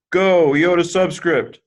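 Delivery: high, loud, drawn-out rooting call; mock cheer. Teacher rooting for a tiny Greek letter, mock cheer. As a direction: cheer it on playfully, slow and high.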